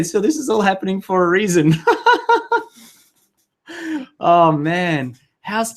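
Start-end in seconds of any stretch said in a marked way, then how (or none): 1.8-2.6 a sudden high burst of laughter; high giggle. Real, not acted. Host laughing at her own tech panic, high giggle.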